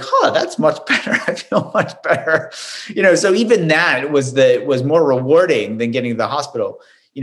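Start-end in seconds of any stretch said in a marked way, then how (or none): 0.0-2.5 pleased words broken by a laugh; laughing relief. Retold or imitated. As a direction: chuckle through the relief. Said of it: "I was like".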